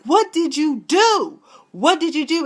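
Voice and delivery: hammed-up high alarmed yell; mock panicked yell. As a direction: shout it in shock. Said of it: "So Matt was like".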